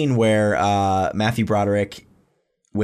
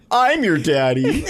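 higher, drawn-out mock declaration; hammed-up movie dad, laugh breaking in; imitated dad line; 5 semitones higher, slower than usual. Dad to his dancing daughters, hammed-up declaration. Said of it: "And he goes".

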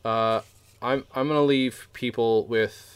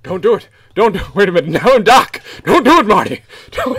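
high, very loud movie-scientist panic; hammed-up high-pitched alarm; frantic acted shouting; 10 semitones higher, 14 dB louder than usual. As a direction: yell a desperate warning, hammed up. Acted frantic Back to the Future plea.

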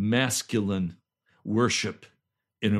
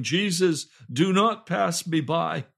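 raised, pleading call to Jesus; crying out; 5 semitones higher, 4 dB louder than usual. Retold or imitated. Pastor re-enacts his morning prayer to Jesus, crying out. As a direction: cry out in earnest prayer. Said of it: "a loud voice crying out".

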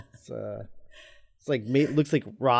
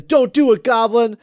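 goofy hero line; 8 semitones higher, 8 dB louder than usual. Retold or imitated. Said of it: "Power Rangers thing".

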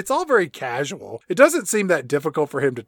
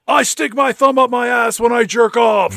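mock confession; 9 semitones higher, 5 dB louder than usual.

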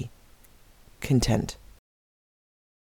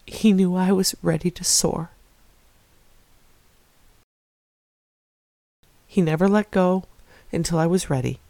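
real emotion welling up; 3 semitones higher than usual. Real, not acted. Telling viewers how God never let go, emotional and near tears. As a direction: let the emotion catch in your throat as you speak. Tearful testimony about God's presence.

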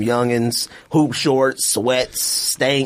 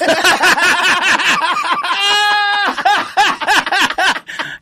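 high, loud, long burst of laughter; 4 dB louder than usual. Real, not acted. Caught in a slip about the girlfriend, loud laughter. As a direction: crack up hard at the slip.

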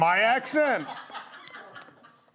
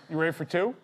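ordinary talk, nothing marked

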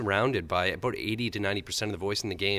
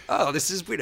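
surprised; 9 semitones higher than usual. Retold or imitated. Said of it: "I'm like".

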